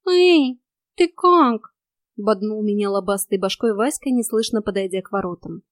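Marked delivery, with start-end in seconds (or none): 0.1-1.7 higher, louder call to a friend; cat hailing someone; lightly voiced cat. A narrator's reading. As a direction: call out a quick, raised check-in. Cat calls out to narrator.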